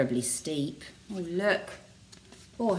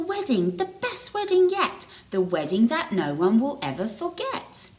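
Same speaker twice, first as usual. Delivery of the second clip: scarecrows calling; 10 semitones higher, 3 dB louder than usual. A narrator's reading. Scarecrows sing to the cows.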